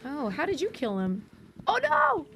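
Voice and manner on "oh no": real panicked yelp dissolving into laughter; panicked shriek. Real, not acted. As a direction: shriek in fright, then laugh it off. Sudden danger in game.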